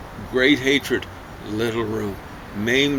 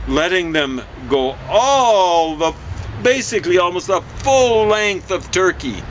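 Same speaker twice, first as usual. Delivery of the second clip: heated rant; 6 semitones higher than usual. Real, not acted. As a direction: push it up in exasperation. Heated rant about Turkey.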